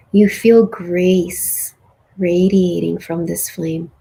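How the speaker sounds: calm meditation guide closing the journey; guided meditation. Acted. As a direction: guide them gently through the closing.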